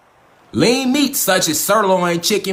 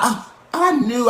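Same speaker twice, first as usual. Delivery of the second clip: slow, higher, smug mock claim; mock know-it-all; 5 semitones higher, much slower than usual. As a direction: drag it out like a faker pretending he knew. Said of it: "Let's not".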